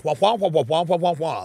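muffled trombone-like wah wah; mock the teacher's wordless drone; Charlie Brown adult voice. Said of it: "she sounds like the Charlie Brown adult".

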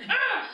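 sudden high cry of alarm; short high shriek; panicked high yelp. Retold or imitated. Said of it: "I'm like".

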